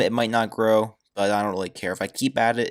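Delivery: ordinary delivery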